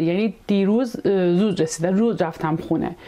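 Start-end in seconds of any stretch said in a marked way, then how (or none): none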